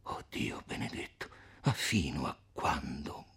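hushed, anguished cry under the breath; whispered exclamation. A narrator's reading. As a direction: whisper it into your hands in despair. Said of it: "si coprì il volto con le mani ed esclamò".

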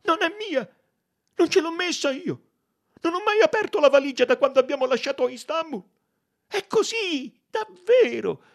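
high, loud, hurried protest; panicked cry. A narrator's reading. Maid denies owning the uniform, crying out frantically. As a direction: plead your innocence at the top of your range. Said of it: "gridò".

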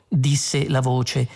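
usual speaking voice

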